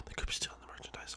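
barely audible, gasped whisper; suspicious whisper. Pretend. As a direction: whisper it as if intruders might hear. Repeats it in a secretive whisper.

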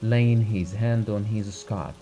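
plain reading voice

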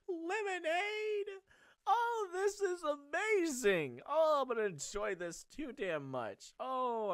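high, loud, slow squeal of joy; tearful joy. Real, not acted. Gamer to viewers, overjoyed at lemonade, high and loud. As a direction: gush with delight, voice up high. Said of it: "I'm actually in tears of joy".

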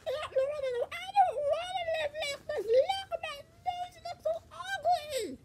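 high-pitched, sobbing whine through tears; high crying. Acted. Hurt Yoshi whining to his aunt, crying. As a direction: whimper it like a hurt little kid, voice breaking. Yoshi cries over broken nose.

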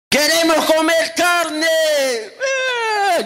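hammed-up tearful demand; mock crying whine. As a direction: whine for meat like a spoilt child. Israelites crying for meat.

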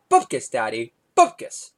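put-on squeaky little kid saying bupkis; toddler voice. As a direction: deliver it like a proud two-year-old.

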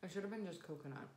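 talking while chewing pastry; speaking between bites; mouth full, chewing. Real, not acted. Seen 3: Left woman holds pastry at mouth, jaw working while talking.

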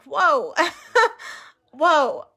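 whoa with a high laugh in between; stunned, laughing whoa; amazed laugh. Retold or imitated. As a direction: laugh in disbelief at the moment. Her own stunned reaction, laughing.

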